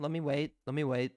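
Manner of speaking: ordinary talk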